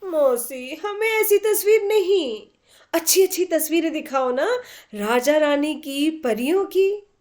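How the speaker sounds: put-on high, tearful little girl begging; pleading little girl. A narrator's reading. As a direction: beg for nicer pictures, near tears. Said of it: "करुण से करुणतर होता जा रहा था". (मौसी से रुआँसी होकर विनती करती बच्ची)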